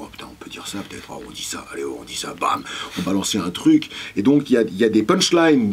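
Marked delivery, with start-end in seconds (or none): 0.0-2.6 hushed, half-whispered retold plotting; conspiratorial aside. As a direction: murmur it low, like daring each other. Acteurs complotant une blague, rejoué. (messe basse complice, presque chuchotée)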